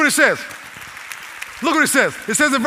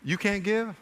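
soft, understated questioning; hushed, mild reproach as God; very gentle; 8 dB quieter than usual. Acted. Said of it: "here's another way he might say it".

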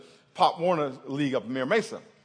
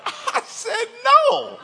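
very high, loud retold "no"; exaggerated high-pitched shout; mock outburst; 8 dB louder, much slower than usual. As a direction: blurt it out way up high for laughs. Comic retelling of blurting out no.

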